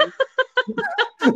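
high-pitched burst of laughter; laughing fit, high. Real, not acted. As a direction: crack up.

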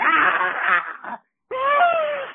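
high mock screams of panic; retold panicked screaming; imitated crowd screams. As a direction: mimic people shrieking, high but not full volume. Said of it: "You hear people scream".

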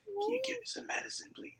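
thin, high, faint sick plea; feeble sick voice. Retold or imitated. Sick man weakly begging his girlfriend for medicine, feeble voice. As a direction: beg weakly like you can barely talk. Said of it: "I said it in my coughing voice".

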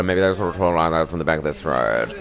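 lightly put-on posh Melbourne accent, placed back in the throat; posh Australian accent. Shown as a demonstration. Demonstrating South Yarra talk, posh throaty Australian accent. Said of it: "I always like posh accents".

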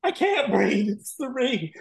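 high, slow breathless "It's Cirie"; starstruck. Retold or imitated. To Andy on the train, high starstruck gush. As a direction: gush like you can't breathe. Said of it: "And I'm like".

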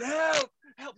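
very high, thin squeal for help; mock cries for help. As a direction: imitate two panicked swimmers, played for laughs. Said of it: "me and Daniel going".

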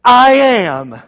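"I am" loud and drawn out; big, weighty stress on "I am"; capital-letters emphasis. Shown as a demonstration. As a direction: boom out "I am" as if in capitals. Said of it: "He could have said in capital letters".

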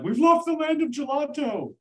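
high, mock-tragic wail; hammed-up grief over lost gelato; mock lament. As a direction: moan it like a small tragedy. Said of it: "and I'm like".

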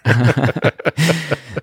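high chuckle; real laugh. Real, not acted. Co-host jokes the audition got nowhere, laughing. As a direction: laugh along at a joke. Reacting to co-host's joke.

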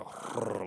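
imitated rolling-door noise; mouth-made rumble of a sliding door; sliding door whirr. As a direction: roll out a whirring door sound. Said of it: "it sounded like a fucking, like, sliding door rolling".

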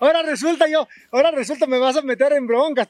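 high, loud, laughing through the words; laughing protest. Real, not acted. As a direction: protest while cracking up. Laughing at the teasing. (protesta entre risas)

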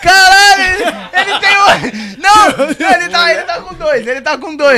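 high, loud excited laughing shout; laughing disbelief. Real, not acted. Shocked laughter at the eater. (rindo alto, sem acreditar)

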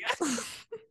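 shrill burst of laughter; squeaky laugh; high squealing laugh. Real, not acted. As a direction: laugh high, nearly crying. Laugh after mock commenter.

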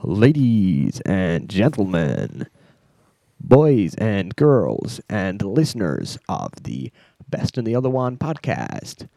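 slow, drawn-out American emcee voice; American accent announcer. Pretend. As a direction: stretch each word, American showman style. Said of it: "doing my American accent for the start".

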